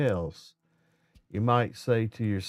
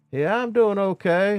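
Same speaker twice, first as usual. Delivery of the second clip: worker's reply pitched higher and louder; 9 semitones higher, 6 dB louder than usual. Retold or imitated. Plumber on the ladder answering the supervisor, higher and louder.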